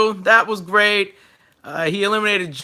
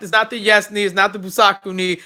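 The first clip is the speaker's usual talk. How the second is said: ordinary delivery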